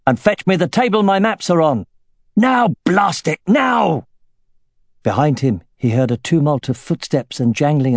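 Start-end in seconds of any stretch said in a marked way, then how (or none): 0.1-1.9 loud, high urgent call. A narrator's reading. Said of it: "he called". 2.4-4.1 slow, high, loud angry urging; impatient shout. A narrator's reading. Impatient commander urging men.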